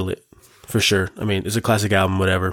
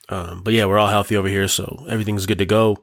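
usual speaking voice

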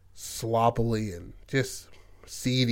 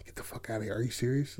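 retold whisper; 10 dB quieter, faster than usual. Kevin hushing to Kristen, a softer retold whisper. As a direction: murmur it close so nobody else hears. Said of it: "whispering to her".

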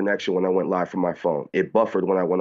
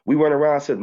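ordinary talk, nothing marked

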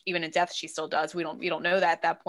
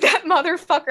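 higher and louder joking curse; half-laughing fake fury at a villain; mock outraged outburst; 9 semitones higher, 8 dB louder than usual. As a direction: blurt it out, amused and indignant. Own thought, building outrage.

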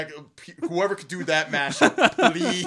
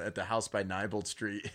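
ordinary delivery

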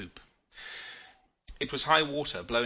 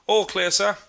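high, loud sailor's report; called-out report; 9 semitones higher, 7 dB louder than usual. A narrator's reading. Sailor reporting to his officer after the crash, called out high. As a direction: call it up to the officer.

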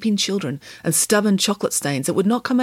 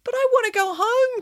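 very high whine; complaining. A narrator's reading. Jogger ordered to buy chocolate, high complaining whine. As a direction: plead like a put-upon victim. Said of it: "complained the jogger".

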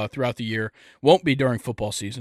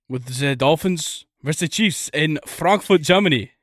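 hammed-up bad German accent; a clumsy stab at a German accent; 3 semitones higher than usual. Podcast host opens the Frankfurt game recap in a bad German accent. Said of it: "not doing the accent".

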